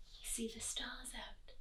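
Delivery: soft whispered aside; whispering. Acted. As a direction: hush it to the kids.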